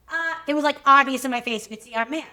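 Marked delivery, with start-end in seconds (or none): none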